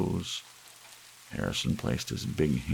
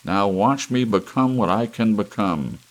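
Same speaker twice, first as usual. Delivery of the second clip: loud, much higher roar; bellowing; 6 semitones higher, 8 dB louder than usual. Acted. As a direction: thunder your greatness at them. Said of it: "he bellowed".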